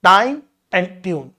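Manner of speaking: normal talking voice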